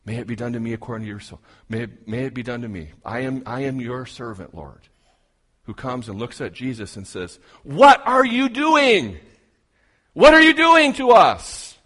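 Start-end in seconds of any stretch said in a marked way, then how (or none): 0.1-4.9 quick, murmured prayer of surrender; a devout servant hurrying through her yes to God; humble submission. Retold or imitated. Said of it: "This is the same Mary". 7.6-11.8 much higher, much louder furious cry; a frightened mother shouting at her lost son; mad. Acted. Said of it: "And she's mad".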